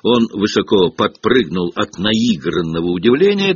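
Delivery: ordinary delivery, nothing marked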